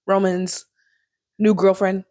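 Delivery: ordinary talk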